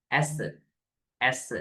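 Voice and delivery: "எஸ்" with a short open ae, "aes"; short ae example. Shown as a demonstration. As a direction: say "எஸ்" with the vowel of "cat". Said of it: "எஸ் என்று சொன்ன". ("எஸ்" குறுகிய ae ஒலியுடன்)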